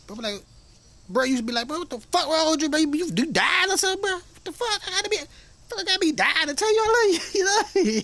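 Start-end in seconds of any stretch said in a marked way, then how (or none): 1.7-8.0 high-pitched, incredulous outburst; loud, squawking disbelief, hammed up; mock alarm. Relative reacting to an unexpected I-love-you, mock alarm.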